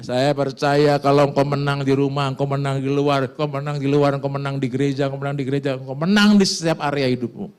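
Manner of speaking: loud, rising preacher's call; preaching. Acted. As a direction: proclaim it to the congregation. Preaching at full force. (khotbah berapi-api dengan suara keras)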